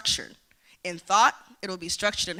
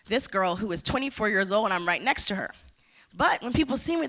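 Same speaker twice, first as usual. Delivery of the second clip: normal talking voice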